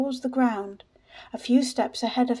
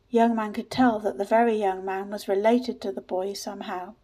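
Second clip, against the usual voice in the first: ordinary delivery, nothing marked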